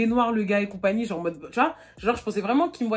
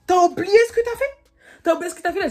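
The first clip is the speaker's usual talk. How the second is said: indignant outburst; 7 semitones higher, 6 dB louder than usual. Retold or imitated. Confronting him with his lie.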